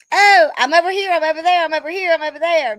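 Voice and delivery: high, loud giggly singsong that settles down; laughing, scattered. Real, not acted. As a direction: bounce the words while still laughing. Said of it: "I'm all over the place".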